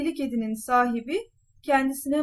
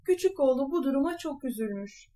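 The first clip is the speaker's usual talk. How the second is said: usual speaking voice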